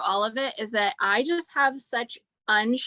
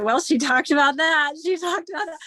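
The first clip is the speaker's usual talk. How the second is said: higher, eager voice of her own thoughts; amazed inner voice; 5 semitones higher, 4 dB louder than usual. Retold or imitated. Listening to the speaker and recognizing herself, eager. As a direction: replay the thought with bright amazement. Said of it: "and I'm like".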